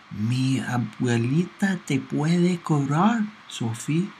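put-on slow, thin little boy's voice; a hint of a six-year-old's voice; thin small voice. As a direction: speak small, like a sleepy child. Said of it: "Pablo's voice was thin and small".

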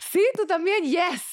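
high-pitched, thrilled agreement; real high excited yes; delighted surprise. Real, not acted. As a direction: squeal with joy at finding a kindred spirit. Delighted to find a fellow sufferer.

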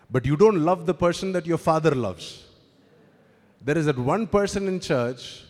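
loud, driving sermon delivery; preaching at full force. Acted. Preacher warning his congregation about church favourites, loud and driving.

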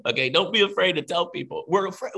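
ordinary talk, nothing marked

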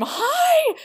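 very high, loud shrieked greeting; excited scream. Retold or imitated. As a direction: shriek it at your idol. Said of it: "and I just scream".

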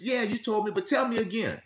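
mildly kind, inviting the story again; patient listener. Retold or imitated. Friend hearing a grieving person's story, gently patient. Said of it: "they'll say".

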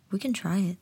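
ordinary talk

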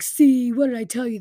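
loud, high, whiny I-told-you-so; sulky king crowing in complaint; pouty and mad. Acted. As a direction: burst out in a petulant pout. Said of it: "King Ahab is all pouty and mad".

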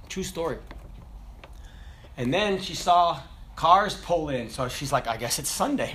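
low, quiet storytelling voice; soft storytelling. Acted. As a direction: draw them in with a hushed tale. Quiet story inside sermon.

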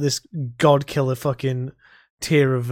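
usual speaking voice

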